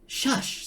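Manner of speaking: sharp unvoiced hush; urgent shush. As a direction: hush the others. Ford hushes the others.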